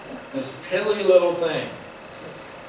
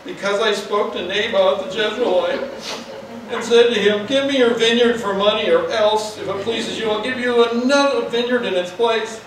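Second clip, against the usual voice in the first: whining king; 7 semitones higher, 4 dB louder than usual. Retold or imitated. Ahab whines his complaint.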